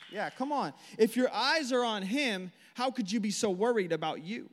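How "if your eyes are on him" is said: slow, high and loud, leaning on eyes; emphatic preaching. Real, not acted. Preacher pressing the point on the church, slow and emphatic. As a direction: draw it out so it lands.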